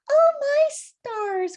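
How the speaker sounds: high, loud, slow astonished exclamation; shocked cry. Acted. Hen reading about foxes, high slow shocked cry. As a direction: draw it out in amazed horror. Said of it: "cried Winnie".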